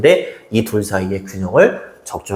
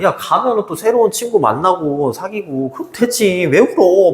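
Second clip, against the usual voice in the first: scolding father; 7 semitones higher than usual. Retold or imitated. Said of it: "막 다그치는 거야".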